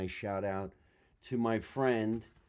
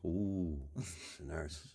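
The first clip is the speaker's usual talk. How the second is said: suggestive ooh; 6 semitones lower, 10 dB quieter, slower than usual. Shown as a demonstration. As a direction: lower and much softer, knowing coo. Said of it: "Watch this".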